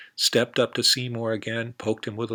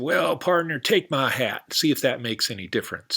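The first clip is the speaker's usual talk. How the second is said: higher, louder cowboy voice; eager cowboy, higher and louder; hearty cowboy; 4 semitones higher, 3 dB louder than usual. Acted. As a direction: boom it out like a big-hearted cowboy. Cowboy character's lines.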